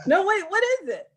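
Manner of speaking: eager, high-pitched question; pleading to hear it now; excited. Real, not acted.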